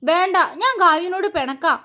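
high, huffy sulking line; pouting mock sulk as he storms off; sulky pout. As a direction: pout like a hurt child. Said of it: "മുഖം വീർപ്പിച്ച്".

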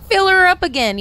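loud, high call; playful holler. Pretend. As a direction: holler it like an order for a refill. Playfully urging more coffee.

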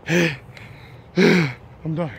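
sighing, out of breath. Real, not acted. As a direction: speak winded after a sigh. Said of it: "I'm out of breath already".

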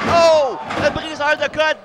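high loud move call; excited call. Acted. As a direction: shout the move's name. Move named at high pitch.

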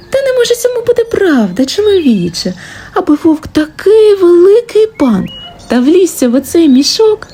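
high-pitched, incredulous fox voice; disbelieving fox. A narrator's reading. Fox tells man it cannot be true, disbelieving. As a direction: play the doubting fox, pitched well up. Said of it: "а лисичка не вірить".

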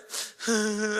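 imitated child sob. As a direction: sob like a toddler starting to cry. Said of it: "하면서 울기 시작을 해요".

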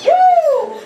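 high, loud joyful whoop; joyful woo. Real, not acted. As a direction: whoop it out in praise. Congregation praise shout.

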